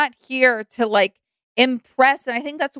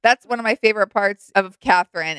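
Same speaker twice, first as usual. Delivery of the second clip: ordinary talk, nothing marked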